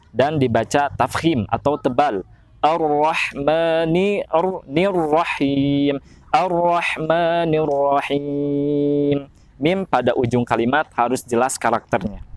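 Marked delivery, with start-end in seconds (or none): 2.6-9.3 slow Ar-Rahmanir Rahim with thick, clear ra; heavy ra. Shown as a demonstration. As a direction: roll the ra of Ar-Rahmanir heavy and full. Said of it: "Huruf ro itu harus jelas". (ra tebal dan jelas)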